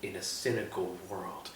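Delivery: imitated hushed, tearful drama; soft, low, halting mock-earnest confession; tearful movie line. Quoting Tom Cruise's big dramatic moment, hushed and tearful. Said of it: "he has a tear in his eye".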